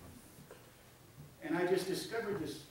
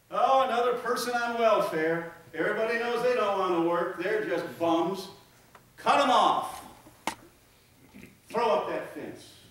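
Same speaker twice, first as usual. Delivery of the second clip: louder, higher, mocking the judgmental neighbour; scornful gossip; 4 semitones higher, 6 dB louder than usual. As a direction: sneer it like a self-righteous critic. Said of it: "shake your head and just say".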